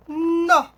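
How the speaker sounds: nó alone, loud and held; stressed syllable. Shown as a demonstration. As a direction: isolate nó with force. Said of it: "Sílaba tônica é um nó".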